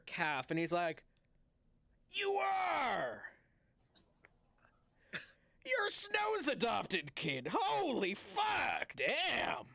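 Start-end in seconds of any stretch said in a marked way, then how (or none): 2.1-9.7 high, slow overjoyed cry; excited shout. Acted. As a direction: bellow it out in delight. Old tiefling recognizes kid.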